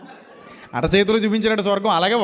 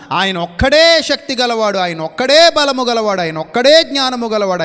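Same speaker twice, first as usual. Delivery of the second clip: preaching at full force; 3 semitones higher, 6 dB louder than usual. Acted. Preaching repeated refrain.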